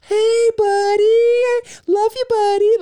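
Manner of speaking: very high, drawn-out loud cooing; dog voice. Retold or imitated. Wife mocking how he talks to the dog, high dog voice. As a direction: gush to a pet in squeaky baby-talk. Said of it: "go into dog voice".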